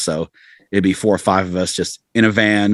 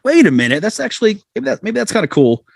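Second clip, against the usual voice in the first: realizing; 12 semitones higher than usual. Retold or imitated. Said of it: "and you go".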